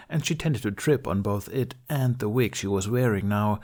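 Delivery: ordinary delivery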